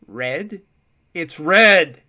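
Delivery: rising into a loud panicked shout; panicked cry. A narrator's reading. Prisoner repeats in panic.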